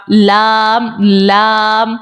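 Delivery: لام drawn out on a level pitch; لام with a clear l and long vowel; articulated lam. Shown as a demonstration. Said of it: "پڑھیں جب آپ".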